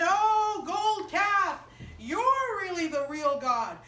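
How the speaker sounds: loud, high, hammed-up praise of the idol; mock worship. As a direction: gush over the gold calf like fervent worshippers. People worship the calf.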